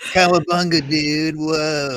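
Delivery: put-on goofy American dude accent through a laugh; Kenny. Mocking a friend's American accent, laughing surfer-dude impression. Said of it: "as good as Kenny's American accent is".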